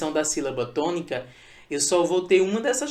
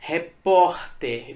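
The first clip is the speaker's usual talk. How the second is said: "Repórter" bem lento, com POR alongado; tônica em POR; much slower than usual. Shown as a demonstration. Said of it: "a sílaba tônica é o por". (sílaba "pór" forte e arrastada em "Repórter")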